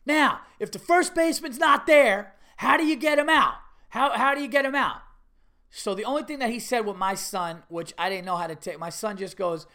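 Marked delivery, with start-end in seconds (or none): 0.0-5.0 loud, high coach voice; quizzing coach. Retold or imitated. Coach quizzing kids on first base, loud and demanding. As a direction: fire the question across the field. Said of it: "And he goes".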